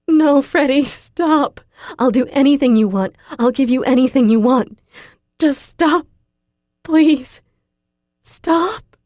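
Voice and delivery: high, loud desperate pleading; desperate begging. A narrator's reading. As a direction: beg him frantically to stop. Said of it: "I beg him in a wheeze".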